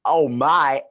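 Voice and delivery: a quick, loud stab at a famous actor's delivery; half-botched impression that slid into the wrong celebrity; Christopher Walken voice. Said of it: "that was my Christopher Walken".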